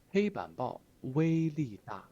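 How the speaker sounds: pompous official pronouncement. Retold or imitated. Teacher voices retired cadre bragging, in official tone. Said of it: "官腔".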